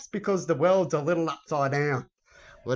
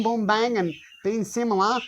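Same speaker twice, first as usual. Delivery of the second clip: ordinary talk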